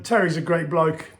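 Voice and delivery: ordinary delivery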